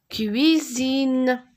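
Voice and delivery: "Cuisine" held long, with ui instead of ou; drawn-out clear "ui". Shown as a demonstration. Teacher correcting cousine to cuisine, drawn-out clear "ui". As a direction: stretch "Cuisine" to show the ui glide. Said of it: "Ce n'est pas une cousine".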